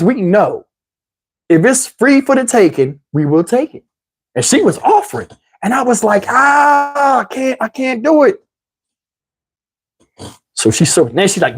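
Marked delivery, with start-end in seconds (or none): 6.3-8.4 high, drawn-out mock anguish; hammed-up high-pitched protest; agonized refusal. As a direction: wail it in comic torment, pitched up. Retold agonized refusal of an offer.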